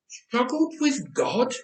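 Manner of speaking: slow, high, hushed wonder. A narrator's reading. Jacob echoes the angel's words in slow, high awe. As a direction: let the words hang in disbelief. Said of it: "Jacob wondered".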